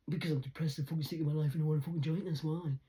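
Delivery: fast, fed-up answer; quick, exasperated retort; blunt outburst. Real, not acted. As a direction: rattle it out in frustration. Blunt answer to own repeated question.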